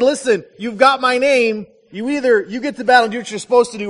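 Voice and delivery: loud and fast, driving the ultimatum home; forceful warning. Retold or imitated. Alexander the Great warning his cowardly namesake, loud and fast. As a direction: bark the choice at him like a conqueror.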